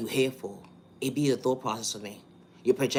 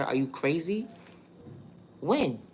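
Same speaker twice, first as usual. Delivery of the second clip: higher pitched disbelief; are you crazy, pitched up and incredulous; 4 semitones higher, slower than usual. Real, not acted. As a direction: scoff at the accusation in disbelief. Denying a false accusation.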